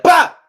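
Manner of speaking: sharp, very high, loud "Pop!" like a shot; mouth gunshot pop. Retold or imitated. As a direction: bark out a gunshot sound. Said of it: "I'd be like".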